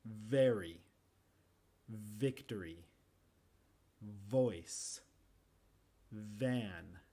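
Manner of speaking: careful lip-to-teeth v in "Very", "victory", "voice"; English v words. Shown as a demonstration. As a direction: model each v word slowly. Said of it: "Los dientes con el labio".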